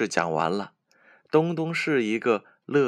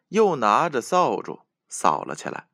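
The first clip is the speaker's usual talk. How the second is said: ordinary delivery, nothing marked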